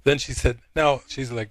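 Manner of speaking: ordinary delivery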